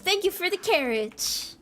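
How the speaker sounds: high-pitched, put-on thank-you; playful high thanks. Thanking a viewer for carrots, playfully high-pitched.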